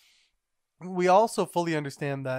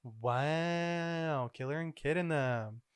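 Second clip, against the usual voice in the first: usual speaking voice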